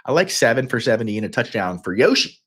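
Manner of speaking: ordinary talk, nothing marked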